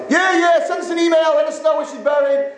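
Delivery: high, excited brush-off; acted giddy indifference; gleeful dismissal. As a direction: rattle it off in a high, jubilant voice. Gleeful relative brushes caller off.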